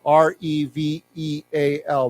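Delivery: slow, letter by letter; each letter given its own beat; spelling aloud. Shown as a demonstration. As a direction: spell "R E V E A L" out slowly for learners. Teacher spells the word out.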